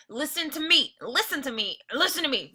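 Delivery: fierce, insistent; fierce mutter. A narrator's reading. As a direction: press it hard. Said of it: "muttered Tom fiercely".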